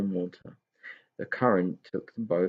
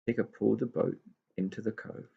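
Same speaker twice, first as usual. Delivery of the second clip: ordinary delivery, nothing marked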